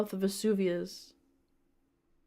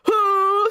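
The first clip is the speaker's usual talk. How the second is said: loud, high held mock gasp; hammed-up drawn-out "huh"; gasp for breath; 12 semitones higher, 7 dB louder than usual. A narrator's reading. Link falls out and gasps for breath, hammed-up gasp. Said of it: "gasped for breath".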